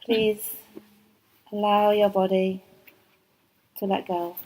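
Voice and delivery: ordinary talk, nothing marked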